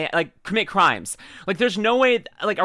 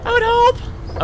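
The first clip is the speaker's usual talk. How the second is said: sarcastic hope; 6 dB louder, much slower than usual. Real, not acted. Sarcastic aside.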